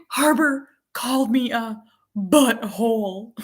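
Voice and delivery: imitated child's tearful whine, slow and high; crying whine. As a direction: draw it out like a whining kid. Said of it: "she's crying and whining".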